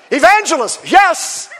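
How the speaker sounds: high, loud, hammed-up revival voice; big, zealous shout; fired-up evangelist. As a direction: imitate an evangelist answering with gusto. Acts out answering to evangelist title.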